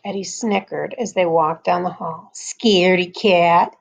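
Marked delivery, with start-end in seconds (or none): 2.3-3.7 slow, drawn-out teasing jeer; taunting. A narrator's reading. Eddie teases Liza walking down the hall, slow taunt. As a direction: taunt her, stretching the words. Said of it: "he whispered to Liza".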